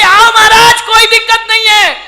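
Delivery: put-on high, loud child calling out; little girl voice. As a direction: call him on like an eager small girl.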